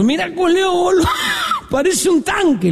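put-on gawp and jeer in a high drunken voice; drunk heckler. Drunk remarks to his mate about a passing woman, high jeering voice. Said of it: "dice el otro".

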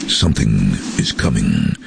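low, ominous trailer voiceover; movie trailer voice. Retold or imitated. Voice artist reading the Jurassic Park trailer, movie trailer voice. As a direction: intone it low like a film trailer. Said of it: "the first line was something".

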